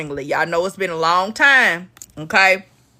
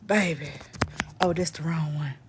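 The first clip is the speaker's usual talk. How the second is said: ordinary talk, nothing marked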